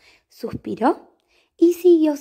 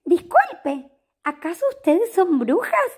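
polite cat voice; 5 semitones higher than usual. Acted. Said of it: "preguntó Horacio".

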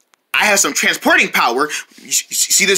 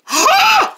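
shocked gasp. Acted.

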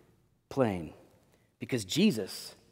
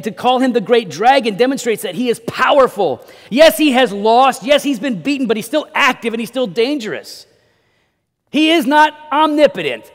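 loud, raised preacher's voice; forceful preaching; 6 semitones higher, 6 dB louder than usual. Acted. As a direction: drive each point home from the pulpit.

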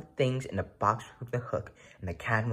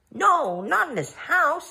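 high, loud fussy protest; the fish squeals its objections, fretful; alarmed fish; 5 dB louder, slower than usual. Acted. Fish in the pot scolding the cat, alarmed and high.

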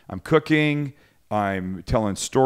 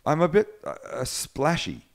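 hesitant imitation of a New Zealander; attempted Kiwi accent; 4 semitones higher than usual. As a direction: imitate a Kiwi accent, halting before the key word. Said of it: "I can't do the Kiwi accent".

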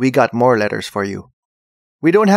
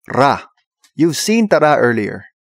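normal talking voice

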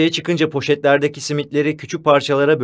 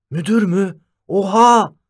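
higher-pitched shocked outburst; rising astonishment, louder on the last word; astonished exclamation; 7 semitones higher, 4 dB louder, slower than usual. A narrator's reading. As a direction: exclaim in loud disbelief. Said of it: "diye haykırdı Dündar şaşkınlıkla".

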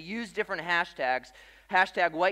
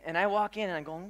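normal talking voice

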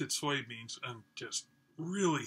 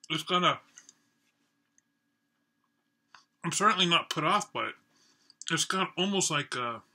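speaking between bites; talking while chewing; 2 semitones higher, 3 dB louder, faster than usual. Real, not acted. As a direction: mouth full of food. Seen 0: Cheeks bulge with food while he speaks, chopsticks and natto bowl shown.

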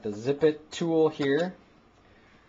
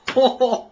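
loud high laugh; 10 semitones higher, 8 dB louder than usual. Real, not acted. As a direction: burst of shocked high laughter. Amazed at what came out.

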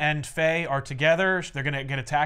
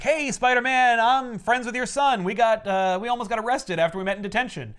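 put-on excited teen girl; 7 semitones higher than usual. Marker greeting Peter, higher bubbly teen voice. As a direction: gush at him like an eager kid. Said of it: "She's like".

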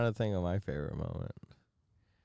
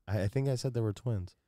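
ordinary talk

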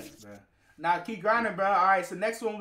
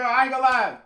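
excited; 5 semitones higher, 8 dB louder than usual. Real, not acted. Hyped reaction to song.

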